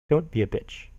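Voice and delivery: normal talking voice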